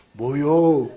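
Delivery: a terse Chungcheong-dialect 뭐요, lightly voiced. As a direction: drop a short lazy 뭐요 in a Chungcheong accent. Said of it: "충청도는". (충청도 사투리로 짧게)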